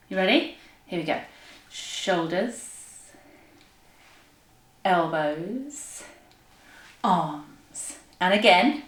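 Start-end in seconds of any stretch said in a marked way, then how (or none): 1.7-8.0 soft, low, drawn-out words; slow exercise count. Shown as a demonstration. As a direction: stretch each word along with a slow roll. Leads slow movement exercise.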